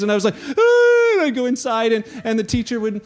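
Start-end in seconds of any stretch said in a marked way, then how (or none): 0.5-1.1 loud, very high crying shout; retold schoolboy's cry of hurt; anguished cry. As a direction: let out a pained wail. Retelling being bullied at school.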